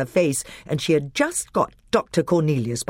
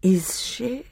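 high eerie half-whisper; grey, terrible voice; 7 semitones higher, much slower than usual. A narrator's reading. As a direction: let it out thin and whispery, with menace. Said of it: "That grey and terrible voice".

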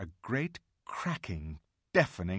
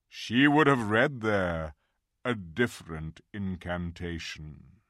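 grand, slow, louder voice of a wise lion; solemn lion; 6 dB louder, slower than usual. Acted. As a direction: speak like an ancient king revealing a secret. Aslan explains the deeper magic.

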